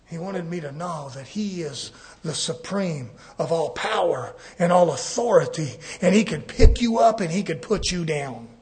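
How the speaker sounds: ordinary talk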